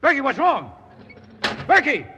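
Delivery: loud, high urgent shouts; calling out. Acted. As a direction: call his name across the dark tunnel. Calling the missing guard in the dark.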